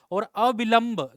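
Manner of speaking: slow, loud अविलंब; drawn-out word. Shown as a demonstration. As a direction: say अविलंब slowly, each syllable held. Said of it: "अविलंब मतलब देर ना करना".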